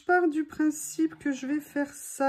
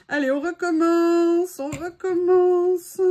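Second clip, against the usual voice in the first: self pep talk; 5 semitones higher, 8 dB louder, slower than usual. Real, not acted. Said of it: "je dois rester digne".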